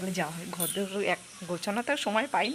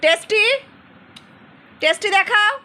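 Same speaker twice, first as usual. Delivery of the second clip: put-on much higher, much louder sing-song baby talk; 10 semitones higher, 16 dB louder than usual. Mother cheering her little daughter eating chaumin, high sing-song baby talk. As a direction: coo and cheer the toddler on. Coaxing daughter to eat carrot.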